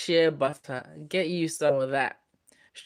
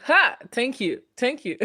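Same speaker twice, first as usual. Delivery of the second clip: delighted laugh; 8 semitones higher than usual. Real, not acted. Delighted by a young guess.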